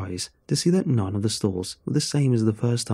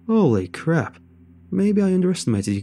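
higher-pitched burst of amazement; startled and impressed, pitched up; impressed surprise; 8 semitones higher, 4 dB louder than usual. A narrator's reading. Ally seeing the handful of stolen coins, impressed surprise. Impressed at the narrator's coin haul.

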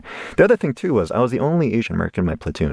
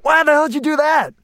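very high, outraged officer's yell; hammed-up incredulous military bark; imitated angry commander. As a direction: act out an officer chewing out a recruit. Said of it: "He's like".

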